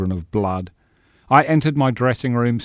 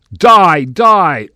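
loud, high-pitched melodramatic yell; murderous stage shout; 8 semitones higher, 12 dB louder than usual. Acted. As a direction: shout it as the stage killer striking. Stage killer attacking victim.

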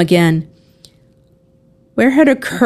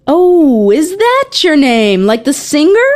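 high, playful question with a louder start; playful teasing; 8 semitones higher, 3 dB louder than usual. A narrator's reading. George teasing the younger girl about her name, playful. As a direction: tease lightly in a raised, lilting pitch.

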